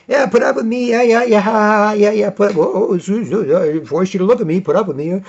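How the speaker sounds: high, jeering taunt; mocking heckler. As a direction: jeer at him like a coward. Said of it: "They come up and".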